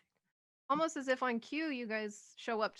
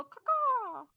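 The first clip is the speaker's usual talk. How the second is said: high, louder caw caw; crow call; 13 semitones higher, 7 dB louder than usual. Acted. NPC kids pick crow call.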